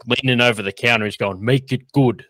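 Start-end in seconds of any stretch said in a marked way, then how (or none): 1.4-2.2 lightly imitated Brooklyn accent; Tony's Brooklyn accent. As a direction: toss off a quick Brooklyn tough-guy line. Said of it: "Brooklyn accent".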